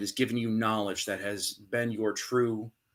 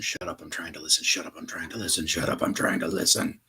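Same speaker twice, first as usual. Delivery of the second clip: creepy echo; 5 semitones lower, faster than usual. Acted. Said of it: "it grew louder and closer".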